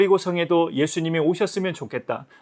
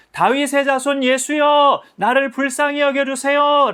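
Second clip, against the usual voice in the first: even higher, louder, slow desperate cry; 9 semitones higher, 5 dB louder than usual. Acted. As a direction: ignore the scolding and shout the plea with all your might. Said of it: "더 크게 소리를 질렀어요". (더 높고 크게 필사적으로 외침)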